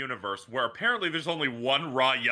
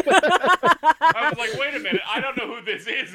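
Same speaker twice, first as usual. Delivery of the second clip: laughing; 7 semitones higher, 7 dB louder than usual. Real, not acted. Laughing while retelling confusion.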